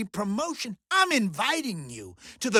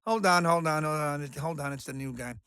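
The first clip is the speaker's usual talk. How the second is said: lower, softer interruption; muttered aside; 5 semitones lower, 6 dB quieter, faster than usual. Acted. Mojo pausing his chat for the new guy, muttered aside. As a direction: drop the volume to shush whoever is on the line. Breaks off chat to take call.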